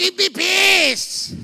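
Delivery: high, slow shouted plea; imitated desperate cry for peace; retold shout. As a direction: yell the prayer at heaven. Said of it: "Kahit sumisigaw ako".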